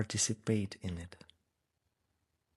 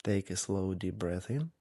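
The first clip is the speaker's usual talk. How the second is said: guided meditation. Real, not acted. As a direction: ease them gently into stillness, unhurried. Leads a breathing meditation.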